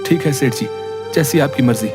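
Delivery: mildly downcast acceptance; sad, resigned servant. Acted. Servant giving up on his wages, sad. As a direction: give in to the master with little energy. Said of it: "नौकर बड़े उदास मन से".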